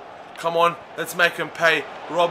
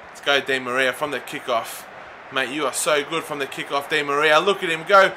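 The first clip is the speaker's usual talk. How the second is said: ordinary delivery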